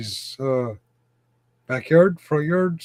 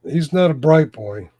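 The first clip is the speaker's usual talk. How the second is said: ordinary talk, nothing marked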